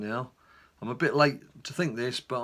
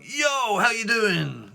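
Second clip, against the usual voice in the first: high, loud, breezy yo greeting; over-cheery greeting; 7 semitones higher, 6 dB louder, much slower than usual. Retold or imitated. On the intercom, expecting his wife home, then a cheery greeting. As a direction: greet the door like an old friend. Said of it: "was like".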